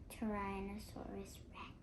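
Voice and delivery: terrified hushed whisper. A narrator's reading. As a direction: breathe the name in dread. Awed naming of the monster.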